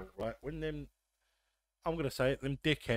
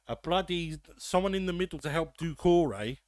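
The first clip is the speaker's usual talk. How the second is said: normal talking voice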